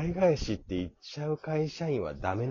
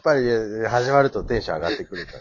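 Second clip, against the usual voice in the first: louder and faster, laughing; louder, livelier; 10 dB louder, much faster than usual. Real, not acted. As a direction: crank the energy up outdoors. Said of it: "ちょっとトーン上げたいからさ".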